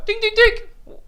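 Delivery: high, loud repeated dings; rapid high bell imitation; imitated doorbell. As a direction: ring it out like a doorbell.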